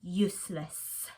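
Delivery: slow, low, lingering; low echoing word. Acted. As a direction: let it hang like poison gas. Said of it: "only one word in the air".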